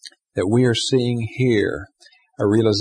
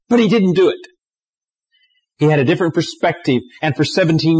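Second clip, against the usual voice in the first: raised, louder preaching voice; impassioned preaching; 5 semitones higher, 5 dB louder than usual. Acted. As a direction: press the point with rising fervour. Preacher rising in intensity.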